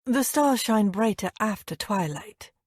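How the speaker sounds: usual speaking voice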